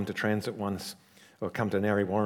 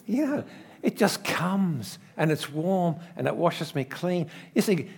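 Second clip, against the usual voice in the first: rapturous; 7 semitones higher than usual. Real, not acted. Rapturous shower image.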